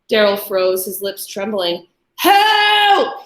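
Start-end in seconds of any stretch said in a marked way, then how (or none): 2.2-3.2 high, loud cry for help; scream. A narrator's reading. Trapped man screaming to the street. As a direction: scream it out toward the window. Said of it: "he screamed toward the window".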